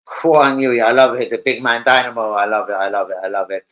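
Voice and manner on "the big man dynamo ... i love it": ordinary delivery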